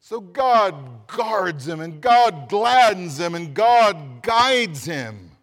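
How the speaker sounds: each God line drawn out and loud; slow emphatic triplet. Acted. Preacher stressing God's care to the church, slow and loud. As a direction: hammer home the three G's slowly.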